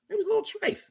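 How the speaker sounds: a teasing high-pitched echo, almost a falsetto; squeaky high repeat. Shown as a demonstration. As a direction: jump way up and go soft on the repeat.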